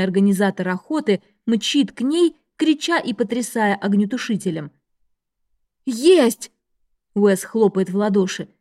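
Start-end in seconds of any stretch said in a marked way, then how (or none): 5.8-6.5 jubilant yell of triumph; excited shout. A narrator's reading. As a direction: shout the win out loud. Target bursts into flames.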